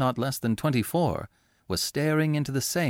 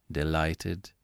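lower, softer, grave reply; 7 semitones lower, 5 dB quieter than usual. Acted. Angus replies gravely to Laura's confession. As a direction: answer with mock gravity. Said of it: "replied Angus gravely".

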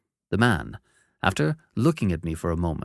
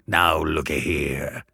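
slow, low convict voice; gruff convict; 5 semitones lower, slower than usual. Acted. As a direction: drawl it menacingly. Convict speaks.

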